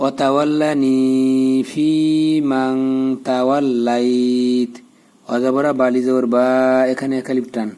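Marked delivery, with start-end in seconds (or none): 0.1-4.7 slow teaching recitation, humming on মাং; joined recitation with ikhfa. Shown as a demonstration. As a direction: stretch "ni", "fi" and "lai", stopping on the t of তাওয়াল্লাইত. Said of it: "ইকফা গুণ্য অনিষ্কার দিয়ে পড়তে হবে". (তাওয়াল্লাইত পর্যন্ত টান ও গুন্না দিয়ে তিলাওয়াত)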